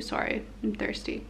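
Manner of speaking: ordinary talk, nothing marked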